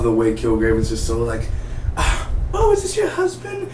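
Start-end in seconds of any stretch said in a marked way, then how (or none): 2.0-3.7 very high put-on voice; imitated nosy neighbor. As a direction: pitch it way up to mimic a neighbor.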